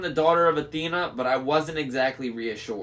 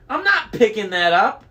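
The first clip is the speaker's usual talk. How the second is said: high, loud protest; shouted refusal; 7 semitones higher, 8 dB louder than usual. A narrator's reading. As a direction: refuse loudly, voice pitched up. Disgusted refusal during the chaos.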